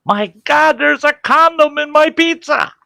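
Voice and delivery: very high, loud mock horror; hammed-up outraged squeal; imitated yell. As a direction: shriek it across the restaurant as a prank. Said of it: "spits it out on the table and yells".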